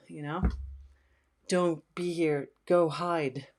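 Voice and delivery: ordinary talk, nothing marked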